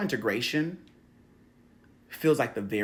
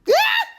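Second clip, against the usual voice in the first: sudden loud high yelp of laughter; short high shriek; 9 dB louder than usual. Real, not acted. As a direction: let out a short, piercing cackle. Sudden high outburst while teaching.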